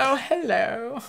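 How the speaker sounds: high, airy lovestruck greeting; swooning pink devil. Retold or imitated. The suited pink devil greeting the stripper angel, high and smitten. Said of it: "He's like".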